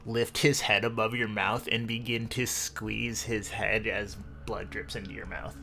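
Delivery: ordinary talk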